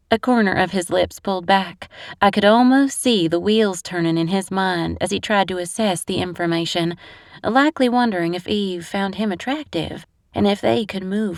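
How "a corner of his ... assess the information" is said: plain reading voice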